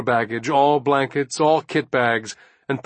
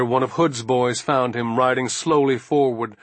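ordinary delivery, nothing marked

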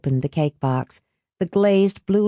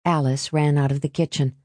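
usual speaking voice

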